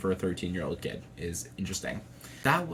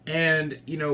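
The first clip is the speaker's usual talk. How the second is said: ordinary talk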